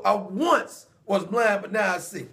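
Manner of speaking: each word spaced out, slow and deliberate; slow emphatic testimony. Shown as a demonstration. Preacher owning the blind man's words, slow and deliberate. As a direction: lay it down slowly like a personal vow. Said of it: "I'm saying I will".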